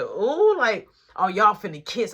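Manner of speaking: loud, high-pitched teasing ooh; mock a friend's excited teasing, loud; teasing excitement. Mark teases them about kissing.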